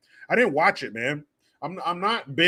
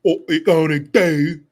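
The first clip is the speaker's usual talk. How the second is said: put-on British accent; 3 semitones higher, 9 dB louder than usual. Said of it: "I have fun with those British accents".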